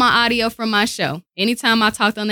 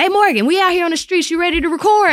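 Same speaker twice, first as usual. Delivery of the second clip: high, quick imitation of a crew calling in; lightly hammed-up, hurried caller; mock eager call-in; 9 semitones higher, 3 dB louder, faster than usual. Street crew checking if the host can record, imitated eager call-in.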